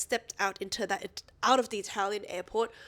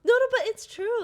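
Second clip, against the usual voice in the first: laughing loud protest, high; 14 semitones higher, 9 dB louder than usual. Real, not acted. Co-host protesting loudly after being mocked. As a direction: push back loud and high against the tease.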